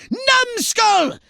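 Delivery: high, loud furious shout of contempt; angry insult. Acted. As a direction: scream the insult at the failed agent. Alien boss berating his agent.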